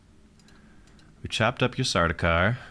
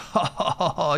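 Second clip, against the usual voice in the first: short, higher-pitched gloating chuckle; gloating laugh; 9 semitones higher than usual. Real, not acted. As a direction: laugh at the rival's predicament. Gloating at a low-supply opponent.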